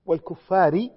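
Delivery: والكفار with an "-ri" ending, said loud; kasra reading. Shown as a demonstration. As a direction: sound the final short "i" of والكفار firmly. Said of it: "أما على قراءة الكسر".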